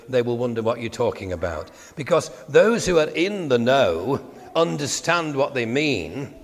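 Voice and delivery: usual speaking voice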